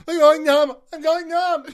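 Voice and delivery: high, loud hammy scream; falsetto shout. Pretend. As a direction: shriek it in falsetto. Acting out the neighbours.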